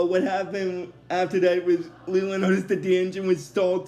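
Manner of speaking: normal talking voice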